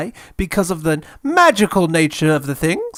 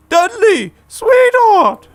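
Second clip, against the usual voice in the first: much higher, sugary mother's voice; doting mother; 13 semitones higher, 3 dB louder than usual. Acted. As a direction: coo at your spoilt boy. Mother dotes on Dudley.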